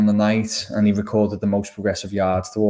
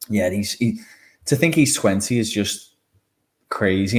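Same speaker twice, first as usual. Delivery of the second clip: normal talking voice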